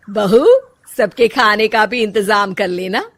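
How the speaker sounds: put-on loud, commanding older woman calling her daughter-in-law; bossy mother-in-law. Mother-in-law orders her bahu, loud and bossy. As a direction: boss the bahu around from the doorway.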